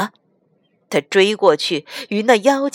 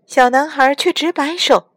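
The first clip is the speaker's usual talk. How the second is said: ordinary delivery, nothing marked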